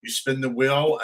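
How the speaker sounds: ordinary delivery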